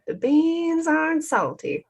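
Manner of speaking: put-on "The beans aren't salty" in a drawn-out Southern accent, high and slow. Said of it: "playing in a Southern accent in my head".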